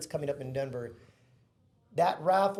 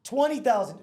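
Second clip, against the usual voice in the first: higher, louder triumphant bid call; excited bid; 7 semitones higher, 8 dB louder than usual. Retold or imitated. Retelling the raffle bidding war, an excited louder bid.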